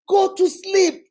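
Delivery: loud, high re-enacted order; fed-up mother yelling; exasperated shout. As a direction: shout it at a baby who won't sleep. Exasperated mother throws baby on bed.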